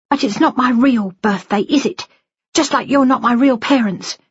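high-pitched hurt accusation; wounded daughter rejecting her parents; bitter daughter. Acted.

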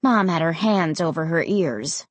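usual speaking voice

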